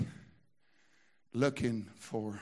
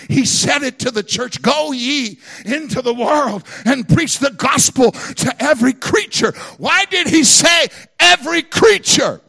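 loud, higher, rising to a shout on every creature; full-force preaching; 12 semitones higher, 17 dB louder than usual. Acted. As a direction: preach the Great Commission to the church.

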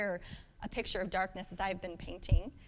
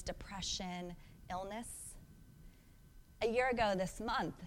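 ordinary delivery

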